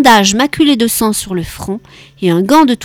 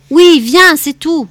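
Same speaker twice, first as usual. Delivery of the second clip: high, loud command that allows no reply; curt and final; 7 semitones higher, 5 dB louder, slower than usual. A narrator's reading. Florian to Charles on the phone, curt and unarguable. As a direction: shut the discussion down. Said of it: "Le ton sans réplique".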